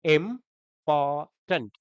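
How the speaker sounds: "Important" in three slow pieces with clear pauses; broken into syllables. Shown as a demonstration. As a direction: chop "important" syllable by syllable, very slowly. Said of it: "तीन टुकड़े हुए।".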